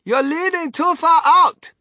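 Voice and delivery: slow, high, loud warning; alarmed father yelling a caution; shouted warning. A narrator's reading. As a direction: shout it out, stretching the words. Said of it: "shouted Mister Gloop".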